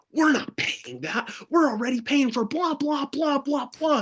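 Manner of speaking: high-pitched imitation of an objecting mom; protesting mom.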